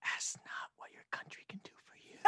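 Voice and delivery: breathy whisper of a famous speech; mock ASMR whisper. Co-host whispers a garbled presidential quote as ASMR. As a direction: whisper the presidential line softly.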